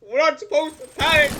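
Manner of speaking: high, very loud outburst; panicked yell. Real, not acted. Player losing composure before the guys, panicked yell. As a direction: burst out yelling in panic. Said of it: "I'm panicking".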